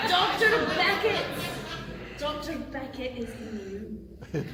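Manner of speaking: put-on Scottish accent, slow and showy. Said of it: "Can you do a Scottish accent?".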